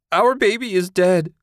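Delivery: high, loud grieving wail. A narrator's reading. Susan wailing to husband about their baby, loud and high. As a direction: wail it through tears. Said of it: "Susan wailed".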